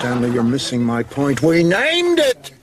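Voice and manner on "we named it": exclamation. Acted. Laughing outburst mid-confession.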